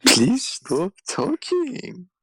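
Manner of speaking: ordinary talk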